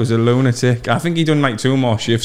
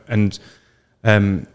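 normal talking voice

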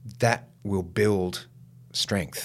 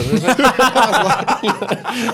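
loud, high burst of laughter; real laugh; 10 dB louder than usual. Real, not acted. Laughing while retelling the pause. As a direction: let a big laugh out. Laughing at his own story.

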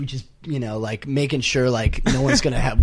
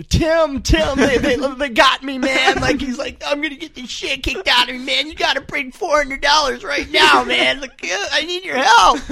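panicked plea; 11 semitones higher than usual. Retold or imitated. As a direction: beg your friend like you're about to get beaten up. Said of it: "he's in panic mode".

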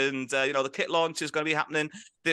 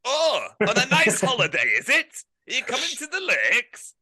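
lightly voiced Yorkshire manager, chuckling; impression of Greg Abbott; 7 semitones higher, 3 dB louder than usual. Manager on a prank call to a footballer, mock gruff. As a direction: play the gruff manager on the phone.

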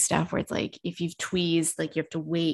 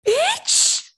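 squeaked much higher in shock; outraged disbelief; 4 dB louder than usual. Retold or imitated. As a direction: squeal it, scandalised. Outraged at the beautician's question.

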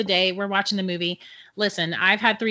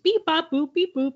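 high-pitched beeps and boops of a kid playing robot; robot noises; 8 semitones higher than usual. Storyteller mimicking a kid in the next stall, playful robot noises. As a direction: imitate a child's robot beeping. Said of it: "like, robot noises".

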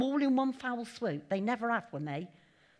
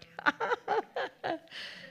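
high laugh; 9 semitones higher than usual. Real, not acted.

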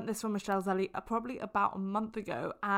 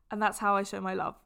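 ordinary delivery

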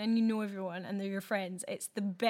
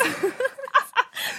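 loud high laugh; burst of laughter; 13 semitones higher, 6 dB louder than usual. Real, not acted. Laughing after mimicking a neighbour, loud high cackle. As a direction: crack up at your own impression.

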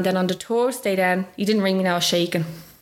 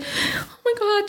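mock crying; 14 semitones higher, much slower than usual. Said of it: "she's raw and crying".

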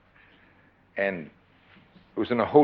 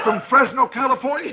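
mock recognition; 14 semitones higher than usual. [name] teasing the conventioneer in the elevator, high mock surprise. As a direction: pretend to know him from his button. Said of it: "I said".

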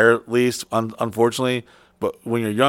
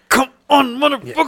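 desperate urging; 12 semitones higher than usual. Retold or imitated. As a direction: beg it to wake up, much higher. Urging his failing body.

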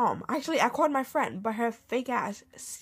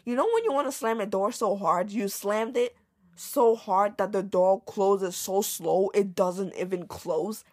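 ordinary talk, nothing marked

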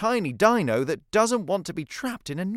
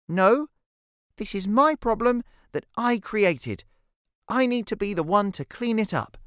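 put-on higher-pitched, firm child's voice; determined young girl; 6 semitones higher, 3 dB louder than usual. Cece stopping Jackson to fix it herself, determined. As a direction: insist in a high girl's voice.

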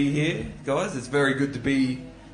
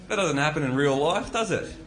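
normal talking voice

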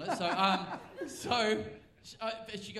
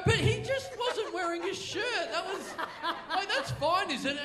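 high, loud laughing protest; 11 semitones higher, 9 dB louder than usual. Real, not acted. Laughing as he protests to the offended woman.

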